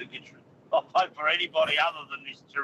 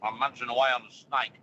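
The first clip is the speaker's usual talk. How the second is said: mouth full; 2 semitones lower than usual. Real, not acted. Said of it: "talking mouthful of food".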